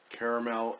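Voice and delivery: caramel with a CARE first syllable; CARE-uh-mel pronunciation. Shown as a demonstration. Reviewer listing variants for viewers, caramel as CARE-uh-mel. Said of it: "there may be other pronunciations".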